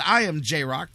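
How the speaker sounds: ordinary talk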